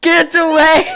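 loud yelling get away; shouting. Pretend. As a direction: yell it to shoo them off. Shouting at character.

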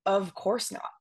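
put-on smug Valley Girl accent with the O sounds drawn out. Fifth grader brushing off a gym classmate, in a smug Valley Girl accent. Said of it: "drawing out the O sounds in my Valley Girl accent".

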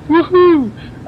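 high loud cheer of delight; excited whoop. Real, not acted. Gamer cheering his new power, excited whoop. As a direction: whoop with joy.

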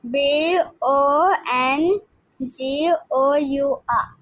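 reading out the spelling of bonjour one letter at a time. Shown as a demonstration. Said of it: "Spelling is".

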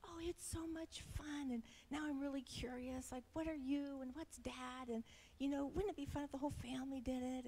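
high, excited daughter voice; bubbly young daughter gushing; imitating daughter. Mother mimicking her excited daughter about DNA. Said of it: "she was like".

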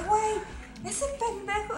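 mock outraged. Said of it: "me dice".

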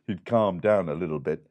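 ordinary delivery, nothing marked

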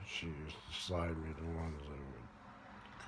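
normal talking voice

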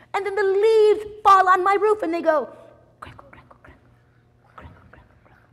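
boil over in complaint; grumpy outburst. Acted. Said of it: "He said".

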